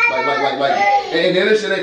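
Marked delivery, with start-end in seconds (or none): 0.1-0.7 mock imitation. Imitating dog owner cracking up.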